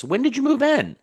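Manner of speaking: very high-pitched curious question. Shown as a demonstration. Coach shows Maria how to question the renter, curious. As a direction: ask it with eager, exaggerated interest. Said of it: "start being curious".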